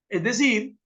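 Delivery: ordinary talk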